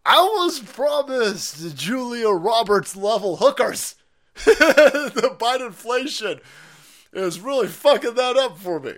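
high, loud, slow words through laughter; laughing complaint. Real, not acted. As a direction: whine about the hookers while cracking up.

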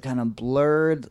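usual speaking voice

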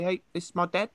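high mock Kiwi accent; New Zealand accent. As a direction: talk from the back of the mouth, Kiwi style. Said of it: "They say".

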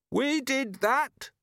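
high, drawn-out desperate cry; screamed confession. A narrator's reading. Trapped robber owning up to anything, screaming. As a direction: cry it out very high, stretching each word. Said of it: "screamed someone".

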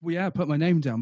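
normal talking voice

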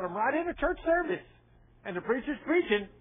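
high-pitched incredulous objection; retold protest. Man objecting to God during church service, imitated high protest. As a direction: protest in mock disbelief, pitch jumping up.

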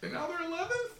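squeaky high cartoon voice; silly high voice. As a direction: play a squeaky little team mascot. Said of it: "that stupid voice".

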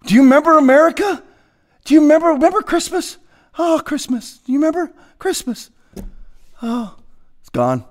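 wistful lament, hammed-up; mock nostalgia. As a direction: reminisce longingly, then drop into resignation. Mock nostalgia for lost America.

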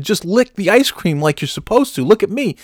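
high-pitched imitation of an exasperated scold; mock exasperated lecturing; exasperated instruction. Retelling Kim's exasperated instruction.

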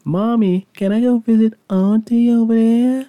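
put-on young him begging his mom for a trip; kid asking mom. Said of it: "I'm like".

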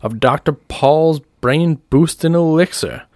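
high, stretched-out product plug; showman's pitch. Acted. Doctor Paul presenting his bottles of elixir, huckster's pitch. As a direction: sell the bottle to the crowd.

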